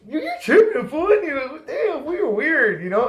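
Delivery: laughing through the words, high and loud. Real, not acted. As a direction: laugh it off while talking. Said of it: "laugh heard".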